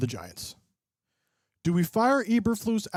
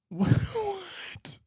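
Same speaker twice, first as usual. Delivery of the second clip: laughing disbelief; 14 semitones higher than usual. Real, not acted. Mom's AI filter question.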